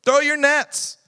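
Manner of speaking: high, loud command across the water; calling out. Acted. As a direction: call it out from the shore. Jesus calling again.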